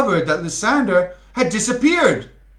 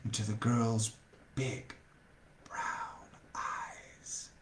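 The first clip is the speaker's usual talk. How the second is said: soft lower voice sinking into a whisper; dreamy whisper; 7 semitones lower, 17 dB quieter, slower than usual. A narrator's reading. Narrator swept up in Lysander's gaze, fading to a whisper. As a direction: trail off in a hushed romantic whisper. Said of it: "I got too much into the role".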